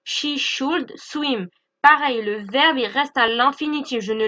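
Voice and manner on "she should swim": She should swim said slowly, word by word; slow model sentence. Shown as a demonstration. Teacher reads the example sentence to students, slow and clear. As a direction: spell the sentence out slowly for learners. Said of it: "j'ai choisi le verbe swim".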